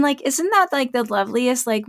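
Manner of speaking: ordinary talk, nothing marked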